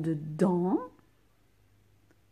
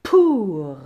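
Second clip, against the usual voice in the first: loud dictation; 10 dB louder than usual. Shown as a demonstration. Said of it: "teacher dictates next word".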